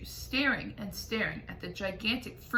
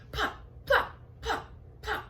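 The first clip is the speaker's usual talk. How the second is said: popping sound effect; 11 semitones higher than usual. A narrator's reading.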